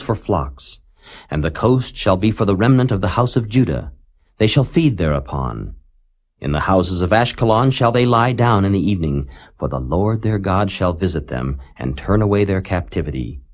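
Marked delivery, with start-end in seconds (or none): none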